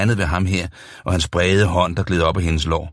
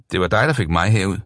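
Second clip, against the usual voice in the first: plain reading voice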